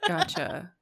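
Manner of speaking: usual speaking voice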